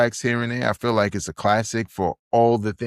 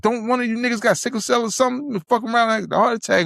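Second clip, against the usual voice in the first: high, fast rapped line; 13 semitones higher, faster than usual. Retold or imitated. Friends quoting the Prodigy diss: high, fast recitation. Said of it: "he was like".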